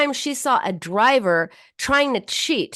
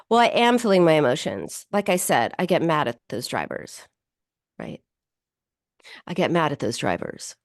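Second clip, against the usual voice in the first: flat matter-of-fact; 6 semitones lower than usual. Shown as a demonstration. Therapist showing how the client flatly reported her anger. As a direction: say it like reporting the weather. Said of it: "this is more like how she said it".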